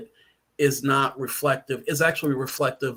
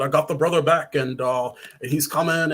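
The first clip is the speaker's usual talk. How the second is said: ordinary delivery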